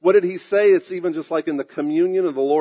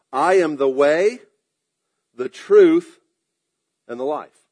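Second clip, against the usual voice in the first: slow, spaced phrases, "truth" louder; solemn measured quote; 5 dB louder than usual. Acted. Preacher quoting Jesus, each phrase set apart. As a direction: weigh each phrase with a pause. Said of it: "And Jesus said to him".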